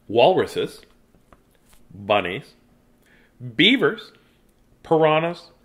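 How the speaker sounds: storytime read-aloud with dramatic pauses; reading to children. Acted. Reads picture book aloud.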